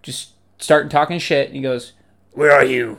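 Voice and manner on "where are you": lightly voiced possessed man, louder; a hint of demon in a louder call; demon-possessed Jeremy Irons. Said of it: "demon-possessed Jeremy Irons".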